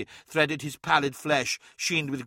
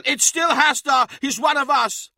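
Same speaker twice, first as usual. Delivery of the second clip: high, loud pleading shout; desperate shout; 13 semitones higher, 8 dB louder than usual. A narrator's reading. Araman defending Hastar to the others, desperate shout. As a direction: beg them to spare him.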